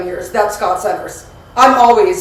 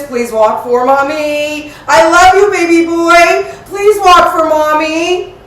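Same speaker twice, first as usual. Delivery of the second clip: coaxing; 6 semitones higher, 4 dB louder, slower than usual. Real, not acted. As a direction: coax gently, drawn out. Coaxing the dog to walk.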